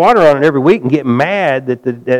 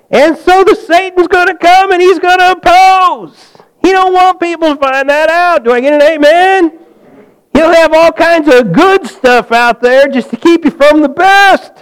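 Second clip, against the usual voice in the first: high, loud preaching build; full-force preaching; 12 semitones higher, 4 dB louder than usual. Acted. Pastor rousing the congregation against Satan's opposition, full-force preaching.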